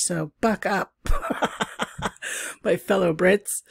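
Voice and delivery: normal talking voice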